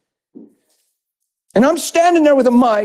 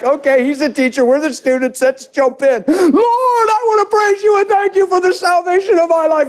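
congregants joining in praise; 9 semitones higher than usual. Retold or imitated. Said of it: "I think they were like".

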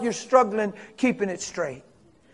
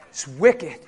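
normal talking voice

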